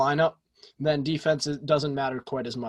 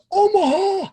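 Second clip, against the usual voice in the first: put-on high, loud old man shout; 6 dB louder, slower than usual. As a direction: bellow it like an old quarterback. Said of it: "His old man voice".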